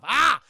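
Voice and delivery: loud, high mock cry; a loud, high yelp; mock outraged cry. The streamer reacting to the maid storming off, a loud, high cry.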